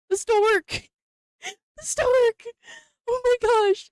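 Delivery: delighted realization; giddy squeal; excited. Real, not acted. Laughing realization about the card.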